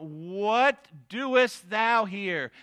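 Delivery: very slow, stretched What doest thou here; drawn-out cry. Acted. God crying out to Elijah in the cave, very slow and drawn out. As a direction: stretch each word like a calling voice. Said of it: "God, uh, cried out".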